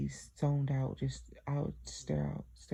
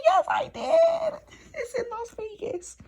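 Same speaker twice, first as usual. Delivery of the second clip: much higher, slow, laughing and crying; laughing through tears; 8 dB louder, slower than usual. Real, not acted. Telling mom about Las Vegas, laughing through tears. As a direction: gush it out half laughing, half crying.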